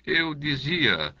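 a mild curate softening his words; softening, placating. A narrator's reading. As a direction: back off gently, smoothing it over.

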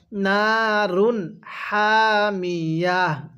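নারুন হামিয়া drawn out slowly; teacher model reading, very slow; slow recitation demo. Shown as a demonstration. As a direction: stop on হামিয়া with a held ending. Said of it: "তাহলে কিভাবে পড়ব".

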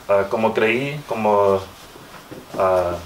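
usual speaking voice

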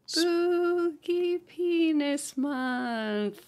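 high slow eerie drawl; ghostly sing-song; spooky voice. Pretend. Joking spooky title for October.

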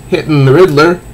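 ordinary delivery, nothing marked

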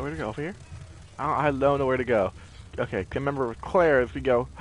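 normal talking voice

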